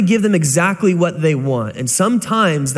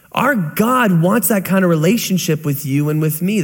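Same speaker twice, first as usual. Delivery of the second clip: ordinary talk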